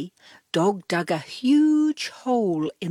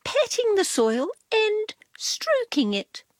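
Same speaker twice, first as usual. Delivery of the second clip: high, prim cat voice; a knowing cat explaining, pitched up; lightly voiced cat; 14 semitones higher than usual. A narrator's reading. Narrator gives Cat a high prim voice. Cat's voice in read story.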